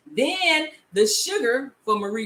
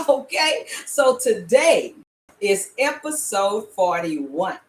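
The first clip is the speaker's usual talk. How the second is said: ordinary talk, nothing marked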